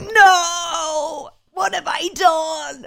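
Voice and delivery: high, drawn-out mock wail of despair; anguished husband. Friend imagines the husband hearing his posh child, mock anguish. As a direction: cry out in hammed-up horror. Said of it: "your husband's gonna be like".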